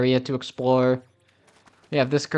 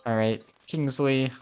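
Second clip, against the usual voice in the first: ordinary talk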